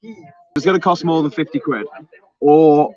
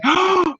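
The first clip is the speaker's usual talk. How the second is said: sharp, high intake of breath; shocked gasp. Real, not acted. Host spots a big super chat, shocked gasp.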